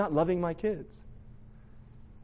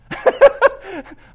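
a burst of high laughter at himself; 13 dB louder than usual. Real, not acted. Preacher laughing at his own gym arrogance, high burst of laughter. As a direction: laugh out loud at your own silliness.

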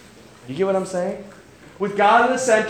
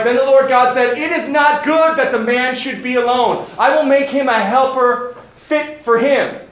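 a lightly raised, measured Bible reading; scripture reading; 3 semitones higher, 4 dB louder than usual. Acted. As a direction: read the passage out to the congregation. Reads Genesis passage aloud.

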